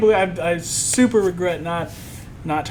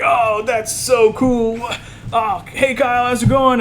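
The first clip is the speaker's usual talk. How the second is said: higher, louder excited greeting; Kevin Smith, delighted; 8 semitones higher, 5 dB louder than usual. Retold or imitated. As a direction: imitate a thrilled, drawn-out reaction. Kevin Smith's delighted reaction, acted out.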